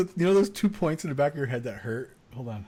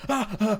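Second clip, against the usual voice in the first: mock pained yelps; 6 semitones higher, 5 dB louder than usual. Shown as a demonstration. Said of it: "you're like".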